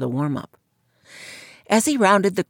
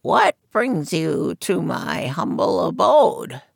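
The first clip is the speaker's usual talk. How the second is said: croaking fortune teller; slower than usual. Acted. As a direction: croak it from behind a curtain. Madame Zora speaking.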